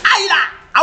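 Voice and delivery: high yelped ouch; acted cry of pain. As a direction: yelp as if pinched hard. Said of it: "Tu cries".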